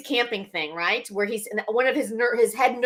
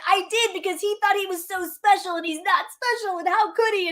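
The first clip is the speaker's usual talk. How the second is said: high-pitched mock-tearful protest; put-on wounded child, pitched up; imitated crying kid; 8 semitones higher than usual. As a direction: plead like an upset kid, hammed up. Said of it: "And this kid's crying".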